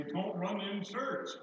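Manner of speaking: imitating the grown-ups who told him off; scolding parent. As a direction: drag out the old church warning. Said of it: "parents would say".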